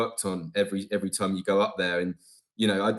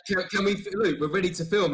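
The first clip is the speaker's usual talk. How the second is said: high-pitched mock plea to get going; retold eager crew; 10 semitones higher than usual. Crew telling Luke they're ready to film, retold high and pressing. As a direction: imitate the crew in a much higher pitch.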